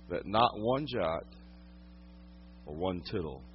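low, soft and grave; quiet preacher letting each phrase land; hushed solemn preaching. Real, not acted. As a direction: drop right down to a reverent hush. Hushed, solemn point in sermon.

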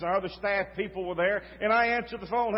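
ordinary talk, nothing marked

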